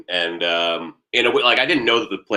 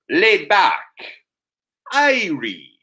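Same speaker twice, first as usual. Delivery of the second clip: Caribbean dude; 9 semitones higher, much slower than usual. Acted. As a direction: parody a laid-back Caribbean dude word by word. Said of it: "a Caribbean dude, you know?".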